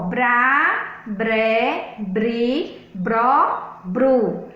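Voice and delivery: "bra, bre, bri, bro, bru" each said alone, vowel held; syllable drill. Shown as a demonstration. Teacher explaining complex syllables, drilling "bra, bre, bri, bro, bru" separately. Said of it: "vou explicar sobre essa sílaba bra".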